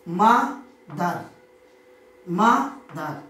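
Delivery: "মা দার" in two beats, a pause after মা; split into two syllables. Shown as a demonstration. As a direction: say "মা... দার" one breath per syllable. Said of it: "এই মা দার শব্দটি উচ্চারণ করতে গিয়ে আমাদের দুবার".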